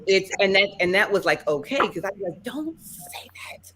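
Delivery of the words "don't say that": high, soft and drawn out; retold hushed plea. Daughter begging her blunt West Indian mom, high hushed plea. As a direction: squeak it slowly under your breath, imitating a plea.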